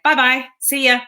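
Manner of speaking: cheerfully dismissive goodbye; breezy send-off. Acted. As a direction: wave it away with a sing-out goodbye. Waving off a negative thought.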